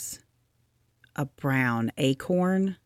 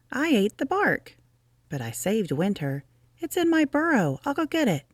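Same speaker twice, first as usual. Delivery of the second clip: Rabbit explaining his plan in a higher voice; small proud Rabbit, pitched higher; higher Rabbit voice, pleased; 8 semitones higher, faster than usual. Acted.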